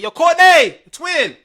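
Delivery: high, loud call-out to Courtney. Pretend. Fan calling out to the rival player from the stands, loud and high. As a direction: hail her across the arena.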